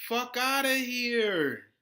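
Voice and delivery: loud, slow, drawn-out brush-off; scornful dismissal. Real, not acted. To hypocrites demanding payback, a loud slow scornful dismissal. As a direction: stretch it out and wave them off.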